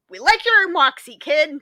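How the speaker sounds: hammed-up tough boss approving; gruff old boss. Funeral home bosses hiring her, in a mock gruff voice. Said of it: "they were like".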